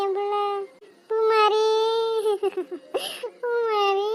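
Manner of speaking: a mother crying out her daughter's name; desperate searching cries fading into tears; frantic mother calling. Acted.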